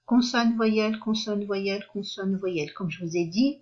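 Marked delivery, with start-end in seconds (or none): none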